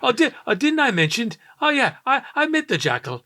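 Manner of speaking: high-pitched bad Irish accent; mock Irish accent. Host mimicking an actor's Irish role, mock Irish accent. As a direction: put on a lilting film-star Irish accent. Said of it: "him doing the Irish accent".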